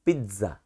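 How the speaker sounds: pizza said softly with a clear tz; pizza, English way. Shown as a demonstration. Teacher models pizza for the class, soft and clear. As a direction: say pizza with the English tz, not pizzo. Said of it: "now onwards we'll pronounce, pronunciate that word".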